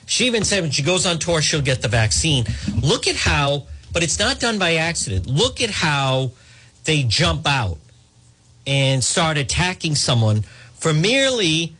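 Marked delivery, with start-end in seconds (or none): none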